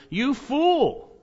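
God rebuking the rich fool, emphatic; emphatic rebuke. Acted. God rebuking the rich fool, a high emphatic cry. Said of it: "It's emphatic".

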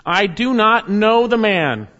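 loud, high, slow denial on oath; forceful sworn denial. Acted. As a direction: swear it out loud to shut them up. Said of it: "he denied it with an oath".

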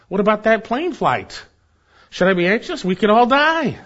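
hammed-up anxious fretting, building to an outcry; mock panic. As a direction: play up a nervous flyer's alarm. Acts out an anxious flyer's panic.

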